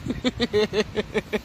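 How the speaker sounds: long chuckle after a joke; amused run of laughter; laughing. Real, not acted. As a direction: laugh at your own imitation. Laughs after her own imitation.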